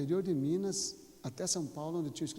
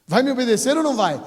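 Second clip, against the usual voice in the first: angry parental shout; 8 semitones higher, 17 dB louder, faster than usual. Acted. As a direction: yell it high and very loud. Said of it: "não vai precisar mais gritar".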